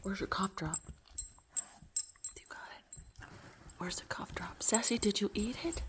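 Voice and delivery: hushed whisper to the dog. Real, not acted. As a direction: drop to a breath, no voice.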